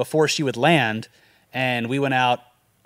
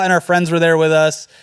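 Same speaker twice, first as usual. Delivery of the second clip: ordinary talk, nothing marked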